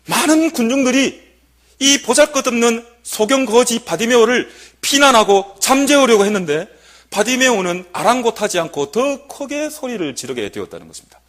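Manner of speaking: usual speaking voice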